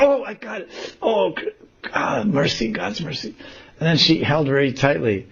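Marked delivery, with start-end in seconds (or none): 0.0-3.3 high, thrilled exclamation; acted delight. Radharani grabs the flower she wanted, high excited voice. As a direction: play her joy in a high voice.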